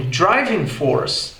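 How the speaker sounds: slow American "driving force" with R; R sounded in "force", slow; American English. Shown as a demonstration. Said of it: "no inglês americano". ("driving force" americano, com R)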